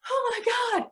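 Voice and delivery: high, startled "oh my God"; surprised gasp. Retold or imitated. Seeing her old friend walk in, surprised. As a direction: shoot up in pitch with surprise.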